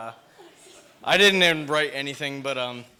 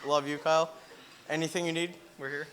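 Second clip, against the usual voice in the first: ordinary delivery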